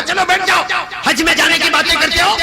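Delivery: mother scolding loud and high; angry mother. Retold or imitated. (गुस्से में डाँटती माँ)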